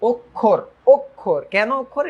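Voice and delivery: অক্ষর with a round "o" first, "ok-khor"; correct pronunciation. Shown as a demonstration. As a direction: say অক্ষর as "ok-khor", clearly set apart. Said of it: "এটার উচ্চারণ কিন্তু". (অক্ষর-এর শুরুতে "ও" উচ্চারণ)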